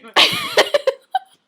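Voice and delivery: loud, very high burst of laughter; shrieking laugh. Real, not acted. Laughing at her friend.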